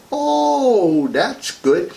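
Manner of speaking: long high "oh" that falls away; hammed-up admiration for someone's ancestry; mock approval. Acted polite reaction to ancestry boasts.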